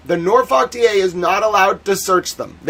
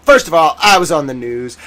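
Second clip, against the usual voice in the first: normal talking voice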